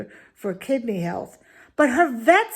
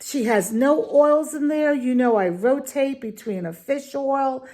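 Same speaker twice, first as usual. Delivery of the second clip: ordinary talk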